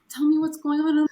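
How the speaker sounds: high, loud, distressed voice. Retold or imitated. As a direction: plead it out in distress. Quoting coworker during breakdown.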